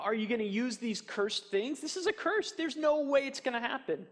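high-pitched incredulous protest; scoffing disbelief. Acted. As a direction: dismiss it as utterly hopeless. Acted mocker scoffing at cursed stones.

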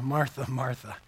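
soft, low, tender Martha, Martha; calm her down softly; gentle rebuke. Acted. Jesus gently answers worried Martha, soft and low.

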